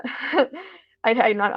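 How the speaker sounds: ordinary talk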